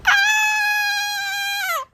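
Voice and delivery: sudden high-pitched squeal. Real, not acted. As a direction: squeal with excitement. Squeal before greeting.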